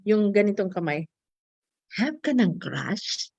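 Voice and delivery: ordinary talk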